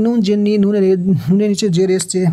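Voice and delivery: ordinary talk, nothing marked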